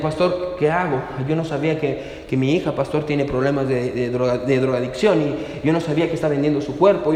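low, subdued retelling of a distraught mother; retold tearful plea. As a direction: quote her plea softly and low, without sobbing. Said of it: "ella estaba llorando". (súplica triste relatada, en voz baja y grave)

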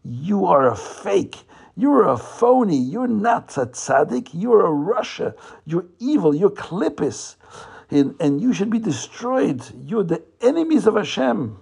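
storytelling tirade, short of a real scream; mock screaming. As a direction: hurl each accusation with acted outrage. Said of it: "starts screaming at him".

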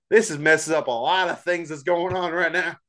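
ordinary delivery

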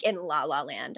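ordinary talk

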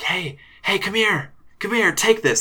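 high, eager whisper-free beckon from the other side; eerie mirror voice. Acted. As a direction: call them over like an unseen creepy stranger. Voice from behind the mirror.

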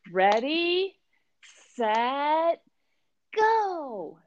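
high, drawn-out mock countdown; real corny. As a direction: sing out a corny group cheer, high and stretched. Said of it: "real corny".

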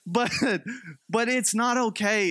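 normal talking voice

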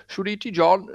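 mock old Welshman hollering hello in Welsh; old Welsh pub shout. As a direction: Welsh old man in the pub, calling out a cheery greeting. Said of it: "they always, like, shout".

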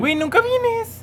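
high, slow mocking whine; sing-song teasing at a friend who skips class. Real, not acted. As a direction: drag it out to tease him. Teasing friend who never comes.

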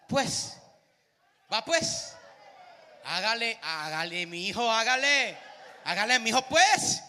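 booming Colombian paisa twang; Colombian paisa accent. Retold or imitated. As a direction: call it out like a loud Medellín local, in a Colombian accent. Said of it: "¿cómo hablan los colombianos?".

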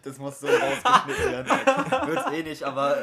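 lachend. Real, not acted. Lachanfall nach peinlichem Versprecher.

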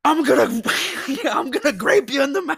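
high, loud trash-talk promo; wrestler promo. Retold or imitated.

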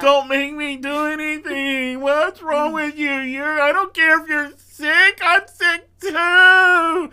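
high, loud whining protest; whiny sick man. Retold or imitated. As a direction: whine like a spoiled patient. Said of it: "Willie gets sick".

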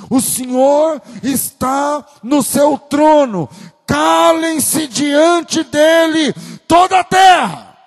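slow, high shouted verse; proclaimed scripture. Acted. Pastor proclaiming Habakkuk to the church, slow and shouted.

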